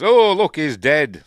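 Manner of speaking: high and loud mock exclamation; hammed-up gasp at the body; mock shocked villain. Voicing the villain finding the body, mock shocked. Acting out character's line.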